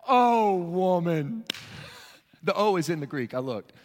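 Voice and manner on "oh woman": very slow, drawn-out Oh woman; Jesus marveling at her faith; admiring exclamation. Pretend. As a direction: savor the words in wonder. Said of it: "And he answered".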